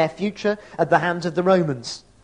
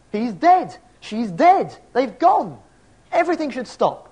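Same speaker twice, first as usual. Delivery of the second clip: higher and louder, building anguish; rising grief protest; 6 semitones higher, 3 dB louder than usual. Pretend. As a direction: cry it out as the bereaved would. Voicing the griever's protest.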